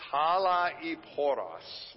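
talaiporos said slowly, syllable by syllable; talaiporos, each syllable clipped apart and drawn out; staccato. Shown as a demonstration. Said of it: "Let's say it together".

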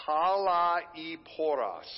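talaiporos said slowly, syllable by syllable; talaiporos, each syllable clipped apart and drawn out; staccato. Shown as a demonstration. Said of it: "let me say it one more time".

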